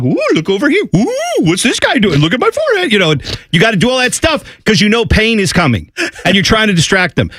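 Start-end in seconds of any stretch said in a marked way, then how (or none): 0.0-2.9 put-on high sing-song baby talk to a child; distracting a baby. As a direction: coo like distracting a toddler before a shot. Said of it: "like a little kid at the doctor".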